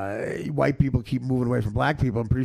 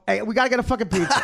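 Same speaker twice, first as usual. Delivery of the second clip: fast, higher American voice; dropped accent; 8 semitones higher, much faster than usual. Retold or imitated. Actor forgetting his Russian role mid-film, dropped accent. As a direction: slip out of the Russian accent into quick American talk. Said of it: "just talked like ourselves".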